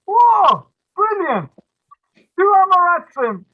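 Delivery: high, loud gleeful cry; delighted shout. Retold or imitated. Matchmaker gleeful at pairing two simpletons, loud delighted shout. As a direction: whoop it out in triumph. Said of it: "So the Shadchan goes".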